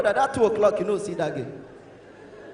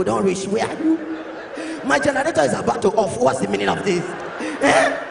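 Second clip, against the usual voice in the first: voice locked up; 3 dB louder than usual. Acted. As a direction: demand answers on a worn-out voice. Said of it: "voice don't lock".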